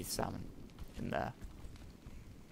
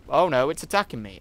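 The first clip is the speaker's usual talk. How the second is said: louder, higher panic; panicked; 4 semitones higher, 9 dB louder than usual. Real, not acted. Gamer attacked by a creature, loud panic. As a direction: cry out as it bites.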